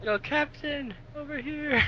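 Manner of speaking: high, held-back call to the captain; hushed high-pitched hail from afar. Acted. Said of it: "I sort of saying".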